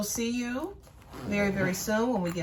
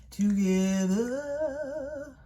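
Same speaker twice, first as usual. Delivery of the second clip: stretched slow and sing-song; drawn out; 6 semitones higher, much slower than usual. Real, not acted. To viewers, stretching out together slowly. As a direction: draw the word out playfully. Dragged out playful word.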